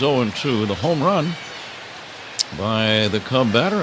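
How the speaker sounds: ordinary delivery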